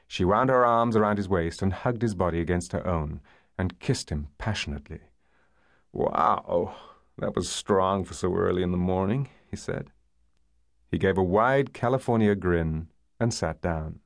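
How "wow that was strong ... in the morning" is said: easygoing American man's voice, lightly gravelly; American accent with a touch of gravel. A narrator's reading. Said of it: "low, gravelly American accent".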